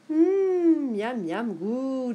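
high, drawn-out hum of relish; yummy, pleased cry; delighted exclamation. A narrator's reading. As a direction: savour it like delicious soup. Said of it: "s'escria-t-elle".

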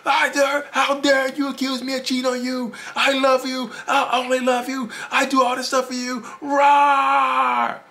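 high, loud roaring outburst; hammed-up enraged screaming, opening and ending on a roar; narcissist blowing up. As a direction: explode in mock outrage, shrieking the denial. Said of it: "they blow up on you like".

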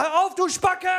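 shouting at the car that won't stop honking; angry shout. Retold or imitated. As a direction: bark it at the driver.

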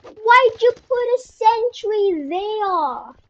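a drawn-out mock British question; unplanned British accent slipped into mid-game. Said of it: "why am I speaking British accent".

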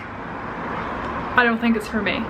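soft, slow, uncomfortable refusal; awkward reply. Retold or imitated. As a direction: say it quietly and reluctantly, dragging it out. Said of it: "it makes me uncomfortable".

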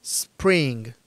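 "Spring" with a held S before "pring"; hissed S, no E. Shown as a demonstration. Teacher checking learners understand, "Spring" with a long initial S. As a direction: hiss first, then finish "Spring". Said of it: "con el sonido ese de la serpiente".

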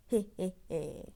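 ordinary talk, nothing marked

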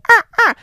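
very high loud crow caws; loud high squawking caw; crow noise. Shown as a demonstration. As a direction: demonstrate a crow's caw. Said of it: "I think that's a crow noise".

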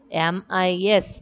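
letter by letter, evenly spaced; spelling out letters. Shown as a demonstration. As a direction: spell each letter out separately.

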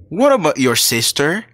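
usual speaking voice

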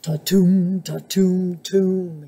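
heavy, rhythmic turtle booms; thumping turtle. A narrator's reading. Turtle joins the band, heavy thumping voice. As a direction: thump out each syllable. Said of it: "she thumped".

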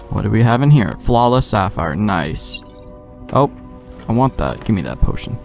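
usual speaking voice

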